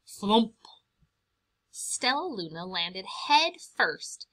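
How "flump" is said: punchy louder flump; sound effect. A narrator's reading. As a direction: pop the landing. Landing sound word.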